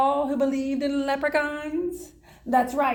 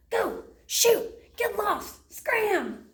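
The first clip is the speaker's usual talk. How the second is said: shooing; 8 semitones higher, much slower than usual. Acted. Leprechaun shooing the dog.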